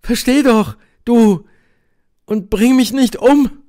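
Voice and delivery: desperate plea, very high and loud; sudden outburst. A narrator's reading. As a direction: burst out, begging him to understand. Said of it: "Und dann brach sie plötzlich aus". (verzweifelter Ausbruch, hoch und laut)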